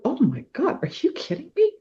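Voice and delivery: high, astonished "oh my God" breaking into a laugh; stunned delight, voice jumping up high; amazed disbelief. Retold or imitated. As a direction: squeal the amazement, then laugh. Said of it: "And I went".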